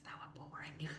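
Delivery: a hushed confession that they bored you; dry whispered aside. Real, not acted. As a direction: whisper it, deadpan. Aside whisper about dull papers.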